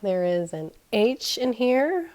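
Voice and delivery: usual speaking voice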